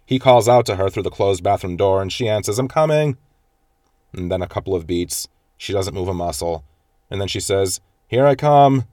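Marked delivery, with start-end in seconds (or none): 2.6-3.2 higher, carrying call through a door; mock call back to the other room. Woman answering husband through the bathroom door, called out. 8.1-8.9 higher and louder, a flat announcing call. Retold or imitated. Woman calling to husband through the door, loud call.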